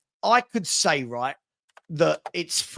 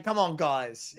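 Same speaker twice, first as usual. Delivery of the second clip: Arteta impression; 3 semitones lower, 7 dB quieter, slower than usual. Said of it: "in a Mikel, Mikel Arteta voice".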